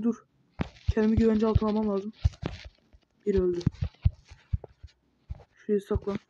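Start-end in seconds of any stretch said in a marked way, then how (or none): none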